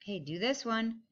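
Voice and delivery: ordinary talk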